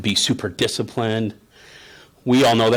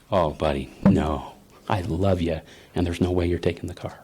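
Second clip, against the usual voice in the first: low, gentle fatherly refusal; calm, loving firmness; 6 semitones lower, 3 dB quieter than usual. Retold or imitated. Parent refusing son the car, calm and kindly firm. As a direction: say no tenderly, without yelling. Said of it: "I should be yelling at him".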